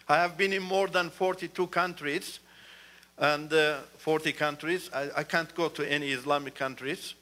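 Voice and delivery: ordinary talk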